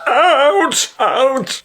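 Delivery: high yelled orders; shouting guard. Acted. Security guard yelling at brawl.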